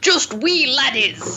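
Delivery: slow, high put-on Scottish accent; bad Scottish accent. Reading the novel's line aloud, bad Scottish accent. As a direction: drag it out in a hammed-up Scottish brogue. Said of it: "is your bad Scottish accent worse".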